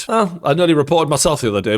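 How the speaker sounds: ordinary talk, nothing marked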